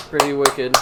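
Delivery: "Pretty wicked" in a light Boston accent. Shown as a demonstration. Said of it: "Wicked accent".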